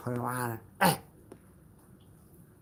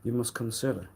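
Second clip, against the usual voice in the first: ordinary talk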